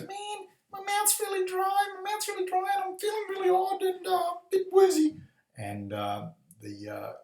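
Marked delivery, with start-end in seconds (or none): 0.0-5.2 very high squeaky lizard voice, getting louder; tiny, high-pitched cartoon lizard feeling woozy. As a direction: whine like a stoned little lizard. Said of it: "the lizard goes".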